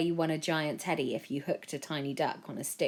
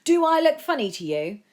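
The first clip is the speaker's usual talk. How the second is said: much higher, louder furious demand; furious man; 11 semitones higher, 9 dB louder than usual. A narrator's reading. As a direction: confront him angrily. Sticky man furious at Joe.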